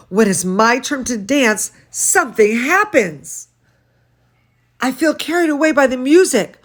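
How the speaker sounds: lively read-aloud voice; storybook reading for children; story reading. A narrator's reading. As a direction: read to the kids with expression. Reads picture book aloud.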